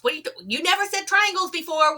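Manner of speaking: retelling the call, suddenly high and quick; shocked disbelief. Retold or imitated. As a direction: jump up in pitch, rushing in disbelief. Startled retort in retold call.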